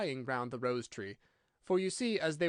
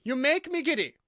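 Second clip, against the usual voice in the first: high, loud shriek; screamed complaint; 12 semitones higher, 8 dB louder than usual. A narrator's reading. Queen complaining to the bowing gardeners, high scream. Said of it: "screamed the Queen".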